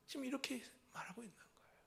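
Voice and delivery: hushed confiding aside, fast; whispered aside. Real, not acted. Preacher confiding to the congregation in a whisper. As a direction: lean in and whisper it.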